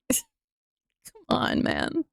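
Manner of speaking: slow, drawn-out whispered plea; exasperated whisper. Real, not acted. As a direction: breathe it out in disbelief. Exasperated aside.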